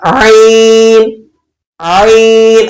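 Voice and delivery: आइन drawn out slowly from the throat; demonstrating ain. Shown as a demonstration. Quran teacher drilling students on the letter. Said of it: "मैं इसकी फिर मशक करवा देता हूँ".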